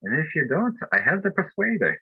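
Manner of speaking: high, hammed-up Irish wife's brogue; Irish accent. Imitating the Irish wife threatening with a frying pan in an Irish accent. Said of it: "I try to do her best Irish accent".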